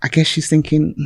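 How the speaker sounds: ordinary delivery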